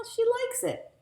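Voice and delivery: put-on high, loud pleased kid; big brother voice. A narrator's reading. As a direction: proudly point it out. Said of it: "said Daniel".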